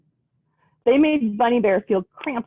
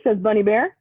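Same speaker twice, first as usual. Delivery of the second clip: usual speaking voice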